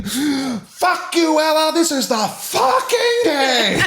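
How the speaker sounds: huge high gleeful scream. A narrator's reading. Narrator repeats Hope's line for co-host, screaming. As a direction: gasp in, then scream it, drawn out to the end.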